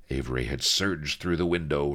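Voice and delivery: usual speaking voice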